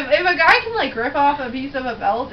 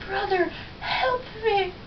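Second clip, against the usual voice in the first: high, soft, slow faltering plea; mock dying plea, high and hushed; weak pleading Mufasa; 6 semitones higher, 6 dB quieter, slower than usual. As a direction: plead weakly from the cliff edge.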